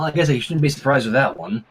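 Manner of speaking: usual speaking voice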